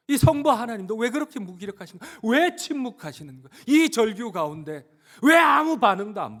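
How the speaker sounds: loud, high cry of protest; anguished pleading. Acted. Preacher asking why the Father stays silent, anguished. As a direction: demand answers at full voice.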